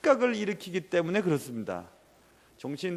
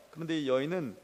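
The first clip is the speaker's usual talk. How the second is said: ordinary talk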